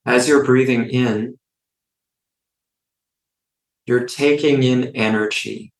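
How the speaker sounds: unhurried phrases with long silences between; guided meditation. Real, not acted. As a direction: lead listeners gently through each breath, in and out.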